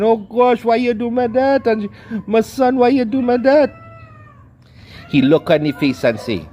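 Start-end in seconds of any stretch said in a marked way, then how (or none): 0.0-3.7 high-pitched imitated crying lament; mock wailing mother. Mother bitten by her jailed son, wailing at him in a high cry. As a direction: wail it up high like a mother in shock. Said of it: "bleeding and crying and saying".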